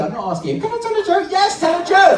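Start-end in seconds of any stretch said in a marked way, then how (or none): 0.6-1.3 high squeaky puppet voice; little dummy voice, pitched way up; Minnie Paul. Acted. 1.3-2.2 loud exasperated shout. Acted. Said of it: "there's no need to shout".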